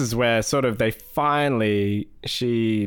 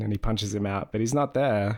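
ordinary talk, nothing marked

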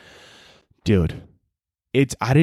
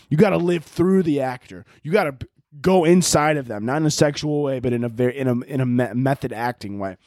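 normal talking voice

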